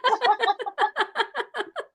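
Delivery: very high, loud peal of laughter; hearty laugh. Real, not acted. Host reacting to guest's joke, hearty laughter.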